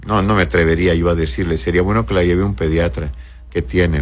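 normal talking voice

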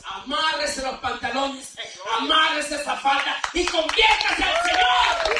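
loud, high, driving sermon; preaching at full force. Acted. Preacher rebuking lukewarm believers, full-force preaching. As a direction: exhort them to come to church.

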